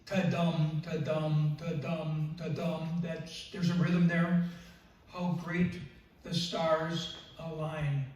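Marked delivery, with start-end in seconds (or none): 0.1-3.0 ta-dum with the weight on dum; iambic beat. Shown as a demonstration. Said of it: "what I kind of refer to as the". 5.1-8.1 slow iambic reading, great and stars hit; slow demo of a poem's beat; stress every other syllable. Shown as a demonstration. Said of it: "would be every other syllable".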